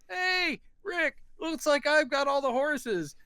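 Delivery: Benny yelling across. Retold or imitated. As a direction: call it over the river, drawing out the words. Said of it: "He's yelling across".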